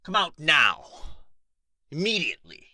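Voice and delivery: enraged dragon shouting at an intruder; furious roar. Acted. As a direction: bellow threats into the dark cave. Dragon yells at hidden intruder.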